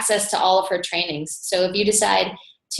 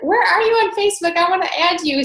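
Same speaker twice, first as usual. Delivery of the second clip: high, bright-eyed eager friend asking to connect; eager new friend; 10 semitones higher, 4 dB louder than usual. Retold or imitated. New friend met on the plane asking to add her, high and eager. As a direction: pitch it up high and a bit louder, all eager. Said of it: "and Amy's like".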